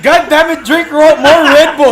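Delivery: shouting through laughter; loud joking outburst; loud laughing yell. Real, not acted. Laughing, urging a flagging co-host.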